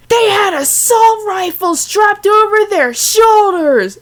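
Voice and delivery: high, loud and slow shouted alarm; frantic shout. Acted. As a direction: yell the warning way up high. Sudden shouted line in story.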